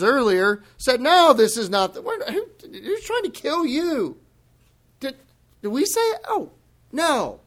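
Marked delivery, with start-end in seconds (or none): 1.0-4.2 high, laughing, mock-indignant protest; mocking denial. Preacher mocking the leaders' denial, laughing and high. 5.0-7.4 high, coy feigned surprise; mock innocence. Preacher playing the leaders feigning innocence, high and coy.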